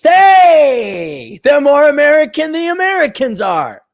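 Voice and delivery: loud, high-pitched exclamation; mock cheer. Cheering after the Russian bit.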